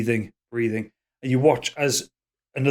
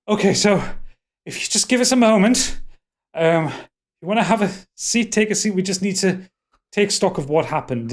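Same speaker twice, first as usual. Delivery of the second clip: higher, louder, placating elf; harried, raised voice making excuses; flustered host; 8 semitones higher, 4 dB louder than usual. Acted. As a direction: politely stall them, voice pitched up. Flustered elf host stalls guests.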